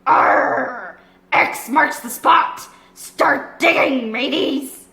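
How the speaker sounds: slow, growled pirate orders; pirate cry. Acted. Long John Silver ordering pirates to dig, slow pirate growl. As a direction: bark the orders to the crew. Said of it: "cried Long John Silver".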